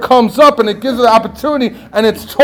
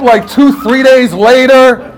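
usual speaking voice

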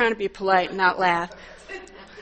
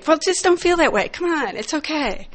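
higher, sing-song parroting of "Well, just don't feel that way"; mock the glib advice in a higher voice; mocking their advice; 6 semitones higher than usual. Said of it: "Well, what they say".

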